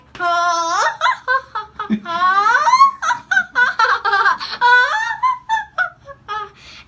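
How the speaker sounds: loud, high wavering wail; long hammed-up howls in bursts; high wailing cry. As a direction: wail in rising, stuttering waves. Imitates reaction in the story.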